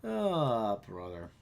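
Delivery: low, fading groan; exasperated. Real, not acted. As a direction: sigh it out, fed up. Exasperated sigh.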